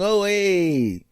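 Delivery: soft, embarrassed little greeting; sheepish recognition. Retold or imitated. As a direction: realise it's your doctor and backpedal. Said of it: "I'm going".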